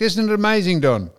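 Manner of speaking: ordinary talk, nothing marked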